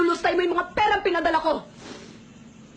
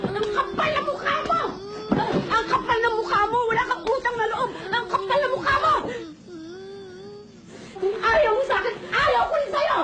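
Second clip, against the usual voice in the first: tearful shouted confrontation; 4 semitones higher than usual. Acted. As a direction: scream it through tears. Mother's tearful tirade at daughter. (lumuluhang sigaw sa komprontasyon)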